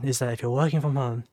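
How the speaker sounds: usual speaking voice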